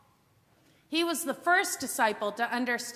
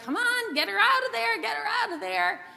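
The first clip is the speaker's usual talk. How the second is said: higher-pitched, insistent sister voice; indignant Martha; 9 semitones higher, 3 dB louder than usual. Retold or imitated. Sister confronting Jesus about Mary, high and insistent. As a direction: imitate a sister demanding action, pitched well up. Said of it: "the sister who's like".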